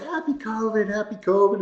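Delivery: light mock cheer. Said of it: "So we're like".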